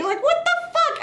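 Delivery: very high squeal of disbelief; exasperated rant. Retold or imitated. Reviewer fed up with the film's family, exasperated rant. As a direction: squeak it out in mock outrage. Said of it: "that's a fucking rant from me".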